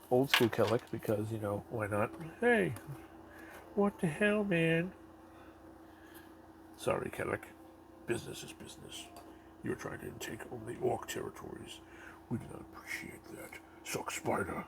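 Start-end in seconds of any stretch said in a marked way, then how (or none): 2.4-4.9 indignant yell. Pretend. Toy figure yelling when attacked. 6.8-14.6 softer, unvoiced villain whisper; hushed mock villain threat; menacing whisper. Villain figure voiced in play.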